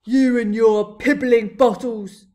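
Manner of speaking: slow, very loud giant bellow; scornful shout. A narrator's reading. Blood Bottler examining rows of bottles, scornful shout. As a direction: shout it slowly with contempt. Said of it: "he shouted".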